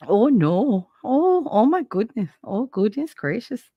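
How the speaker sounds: lightly teasing Minnesota lilt on "oh my goodness"; Minnesotan accent. Retold or imitated. Mocking her ex-husband's home state in a light Minnesotan accent. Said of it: "I love making fun of people from Minnesota".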